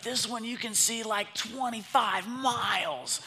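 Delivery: put-on high, boyish excitement, slowing to stretch the distance; excited son. Son shows dad the scope he wants, excited.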